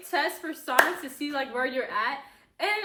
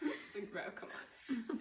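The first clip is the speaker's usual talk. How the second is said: much softer tired plea; mock weary brush-off; 8 semitones lower, 14 dB quieter than usual. Sister retells brushing off a teasing boy, softly fed up. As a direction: sigh it off, fed up. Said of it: "And I was like".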